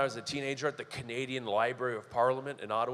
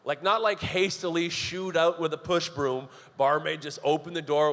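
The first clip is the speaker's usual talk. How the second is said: ordinary talk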